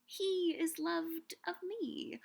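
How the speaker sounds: lightly voiced Helena, drawn out and careful; quoting Helena. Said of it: "she'll say things like".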